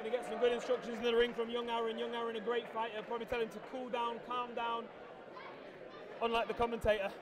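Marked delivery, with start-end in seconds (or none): none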